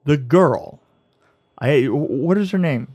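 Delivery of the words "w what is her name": ordinary talk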